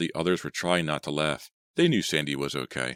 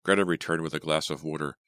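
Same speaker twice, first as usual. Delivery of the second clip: ordinary delivery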